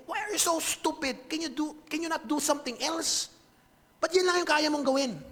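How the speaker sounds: high, exasperated berating; angry Victor. Retold or imitated. Creator berating the creature he made, angry and exasperated. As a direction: scold in a high, frustrated voice. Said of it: "galit na galit si Victor".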